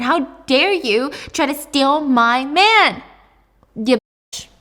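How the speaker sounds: loud, drawn-out outraged accusation; screaming accusation. Acted. Jealous mom accuses daughter over her boyfriend, screaming accusation. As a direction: punch it out slowly in acted outrage.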